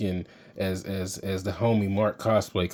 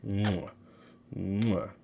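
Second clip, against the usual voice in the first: kiss sound. Pretend. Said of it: "Can you kiss your bicep?".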